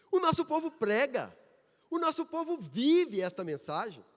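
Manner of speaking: high, loud, emphatic proclamation; rousing preaching. Real, not acted. Preacher declaring to the brothers that their people preach, rousing. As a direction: proclaim it with conviction to the whole church.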